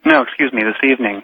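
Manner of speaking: usual speaking voice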